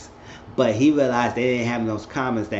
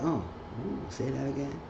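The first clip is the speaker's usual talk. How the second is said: very soft mock swoon; playacted lover's hushed ooh; turned-on murmur; 3 semitones higher, 10 dB quieter than usual. Acted aroused reply to invitation.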